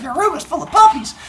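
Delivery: put-on high playful kid voice; silly kid. Teacher voices Ricardo patting kids' heads, high playful kid. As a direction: coo it like a goofy child. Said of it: "He's like".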